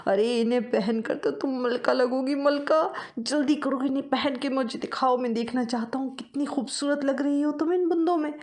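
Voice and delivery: high, excited little mouse husband; excited mouse husband showing off earrings; eager husband mouse. Acted. Husband mouse voiced in story.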